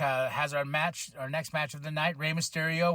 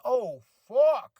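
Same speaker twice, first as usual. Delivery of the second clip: higher, louder shocked reaction; re-enacted shock; 6 semitones higher, 5 dB louder than usual. Reacting to a replay of the steel-steps hit, mock shock. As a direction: react as if watching a brutal crash.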